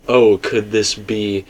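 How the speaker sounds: ordinary talk, nothing marked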